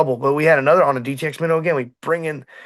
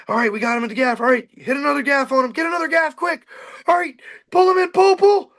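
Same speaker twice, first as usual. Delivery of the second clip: retold frantic shouting; 11 semitones higher than usual. Captain yelling orders at the crew landing a shark, building to a panicked shout. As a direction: replay the chaos on the boat, pitch climbing to a panicked shout.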